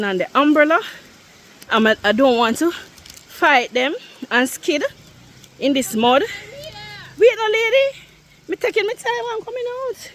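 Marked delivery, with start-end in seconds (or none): 6.2-10.1 calling out. Real, not acted. Calling to friend on trail.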